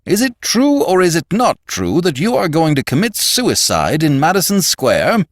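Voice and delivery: loud, high-pitched interrogation by the boss; demanding. A narrator's reading. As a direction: demand an answer, pitched right up. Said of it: "demanded Mister Gowler".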